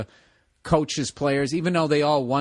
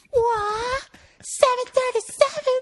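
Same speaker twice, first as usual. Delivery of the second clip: high screamed cry, much higher; screamed at the top of lungs; 3 dB louder, slower than usual. Retold or imitated. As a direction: howl it out like a whole warehouse yelling. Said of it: "the entire warehouse screamed at the top of their lungs".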